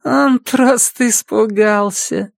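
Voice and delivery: slow, high female character voice; woman's languid high voice with a smile; smiling dazed woman. A narrator's reading. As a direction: say it dreamily, drawn out and high. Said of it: "улыбнулась женщина".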